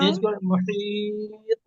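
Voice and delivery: मुहीत with a long drawn ई; lengthened vowel. Shown as a demonstration. Teacher corrects student, stretching the vowel. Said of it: "खींचिए, मुहीत".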